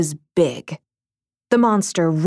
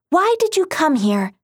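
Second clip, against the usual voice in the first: high, bright heroine question; cartoon princess; 9 semitones higher than usual. A narrator's reading. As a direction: ask like a brave cartoon heroine.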